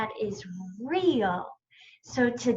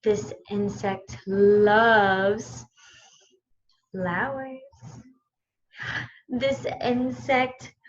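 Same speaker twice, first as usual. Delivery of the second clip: ordinary talk, nothing marked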